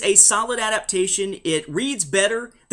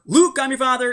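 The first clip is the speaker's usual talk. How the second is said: "Luke" punched high and loud; stress on LUKE; 8 semitones higher, 6 dB louder than usual. Shown as a demonstration. Contrasting misquote with the real line, stress on "Luke". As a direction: hit "Luke" high, then rattle off the rest.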